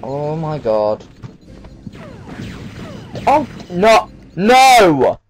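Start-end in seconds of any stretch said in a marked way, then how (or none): 3.3-5.2 high loud cry of dismay; panicking gamer. Real, not acted. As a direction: wail as it breaks. Glitch ruins the run.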